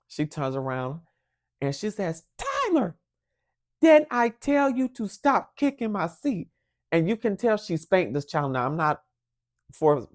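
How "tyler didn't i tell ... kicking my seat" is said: high-pitched exasperated mom; imitated angry mother, voice pitched way up; scolding mother. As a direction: call the child out sharply, then scold. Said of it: "she did the typical mother thing".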